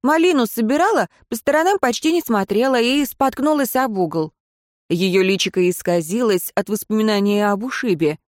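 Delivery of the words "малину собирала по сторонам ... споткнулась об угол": put-on little girl voice. Ghost girl Anika speaks.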